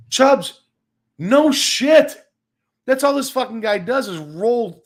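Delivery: loud, high burst of agreement; exasperated shout. Real, not acted. Streamer shouting back at a viewer about a hated player, loud and exasperated. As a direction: yell it out, fed up. Fan complains about Jason Heyward.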